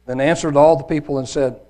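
measured, emphatic Bible reading; scripture reading. A narrator's reading. Preacher reads the trial before Pilate, emphatic scripture reading. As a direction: read it out with solemn weight and stressed key words.

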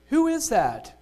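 high, loud shocked scream. Acted. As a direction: cry it out in shock. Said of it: "Sue screamed".

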